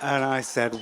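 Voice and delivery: ordinary talk, nothing marked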